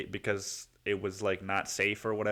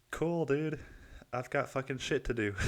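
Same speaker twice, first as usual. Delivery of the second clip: higher-pitched, unimpressed brush-off; mock interest, then brush them off; dismissive reply; 5 semitones higher than usual. Replying to people hyping the Super Bowl, dismissive.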